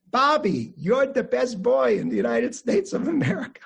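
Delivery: high, emphatic Russian-accented grandfather impression. Grandfather to grandson Bobby in his lap, high Russian accent. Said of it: "say in his Russian accent".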